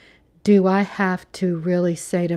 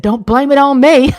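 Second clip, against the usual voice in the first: mock protest; 3 semitones higher, 8 dB louder than usual. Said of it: "Andre said".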